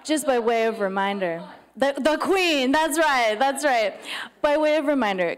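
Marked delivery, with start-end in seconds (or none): 1.8-5.4 higher, louder, projecting to the room; loud sharp voice. Real, not acted. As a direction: call it out like a teacher. Retakes announcement louder and higher.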